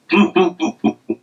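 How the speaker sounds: a short amused laugh after the story. Real, not acted. Host laughing at her own porridge story. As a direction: laugh it off lightly.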